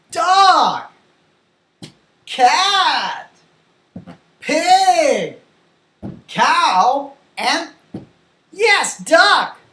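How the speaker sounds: high, falling word by word; big, lifted classroom voice; animated teacher drill. Pretend. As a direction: call out each animal for young learners. Calling out animals to pretend class.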